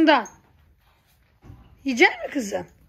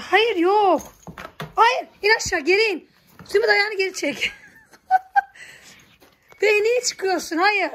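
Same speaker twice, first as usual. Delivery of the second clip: higher and louder, ordering the animal down; firm commands; 6 semitones higher, 3 dB louder than usual. Real, not acted. Ordering Zümrüt to climb down, loud firm commands. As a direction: get her off, sharp and firm.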